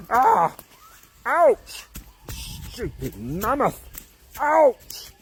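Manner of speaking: high, loud yelps of pain; pained caveman yelps. Acted. Caveman tumbling downhill, yelping in pain. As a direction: yelp as you bounce off rocks. Caveman rolling down hill, hurt.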